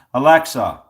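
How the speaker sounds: loud voice-assistant command; calling to Alexa. Real, not acted. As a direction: call it out clearly to a smart speaker.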